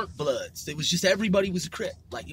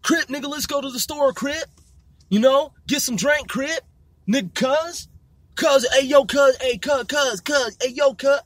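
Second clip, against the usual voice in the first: high-pitched gang-member impression; exaggerated, repetitive Crip banter; imitating Crip talk; 6 semitones higher than usual. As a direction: pitch it up and pepper in the slang. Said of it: "they'd be like".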